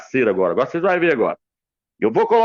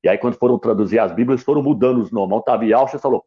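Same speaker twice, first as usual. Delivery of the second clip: ordinary talk, nothing marked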